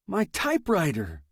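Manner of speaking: high, sudden blurt. A narrator's reading. Man blurting news of his typewriter to Perry, blurted out. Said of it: "I blurted".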